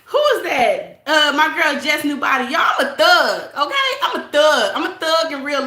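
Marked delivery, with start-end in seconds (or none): none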